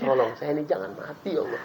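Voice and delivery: soft, pleading pretend prayer; hushed mock plea. Ustaz mimics someone begging God not to die, hushed. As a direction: beg quietly, half joking.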